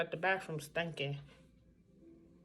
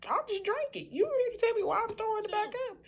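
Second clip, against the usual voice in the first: high, loud, sassy back-talk to the doctor; exasperated retort; 6 dB louder than usual. Retold or imitated. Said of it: "I'm like".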